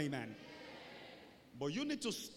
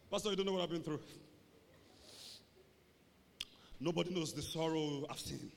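mock self-pity; 3 semitones lower, 3 dB quieter than usual. Acts self-pitying member complaining to pastor.

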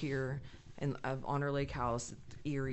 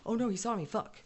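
startled gnome; 7 semitones higher than usual. Retold or imitated. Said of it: "a garden gnome pops out".

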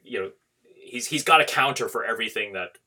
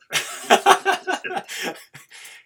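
high burst of laughter; 5 dB louder than usual. Real, not acted. Reacting to I hate you both.

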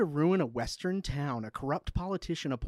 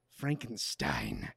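dramatic low voice; 13 semitones lower, 8 dB quieter, much slower than usual. Acted. Dramatic low slow read.

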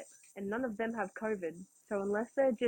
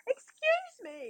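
high, slow drawn-out excuse me; imitating a stern teacher cutting in; mock teacher shushing the class; teacher interrupting; much slower than usual. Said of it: "the teacher's like".

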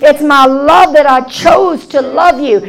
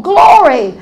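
usual speaking voice

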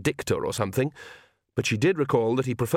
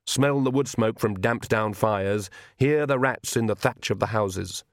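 ordinary delivery

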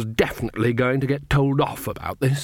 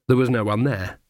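plain reading voice

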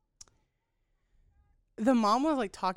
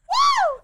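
very high, loud mock "woo"; imitated whoop; 11 dB louder than usual. Mimicking her cheering in the stands, high loud whoop. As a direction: squeal out a piercing imitated whoop. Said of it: "She goes".

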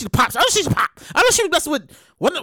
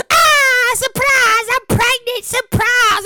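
high, sing-song mock celebrity announcement; 10 semitones higher, much slower than usual. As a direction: chirp it like a coy influencer. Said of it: "Pregnancy pictures".

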